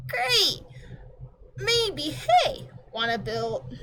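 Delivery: put-on high, fast, offended kid voice; indignant girl. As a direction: snap back at the pompous clock, pitched high. Girl character excited.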